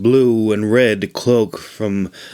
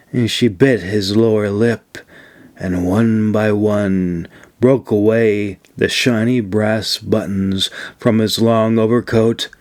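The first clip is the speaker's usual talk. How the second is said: plain reading voice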